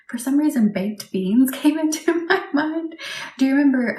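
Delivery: amused, barely holding back laughter; laughing. Real, not acted. Recalls a funny nickname.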